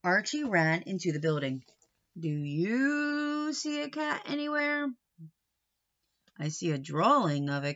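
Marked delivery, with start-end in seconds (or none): none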